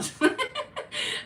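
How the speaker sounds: high, loud burst of laughter; laughing. Real, not acted. Teasing the listener about money, high loud laugh. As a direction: break up at your own joke.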